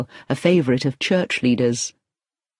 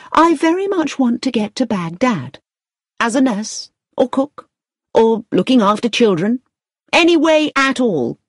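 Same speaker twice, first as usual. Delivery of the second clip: high, earnest plea for work; eager job seeker; 7 semitones higher than usual. A narrator's reading. Victoria asks the manager for any job, eager. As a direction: press your case in a raised pitch.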